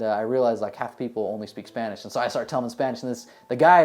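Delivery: normal talking voice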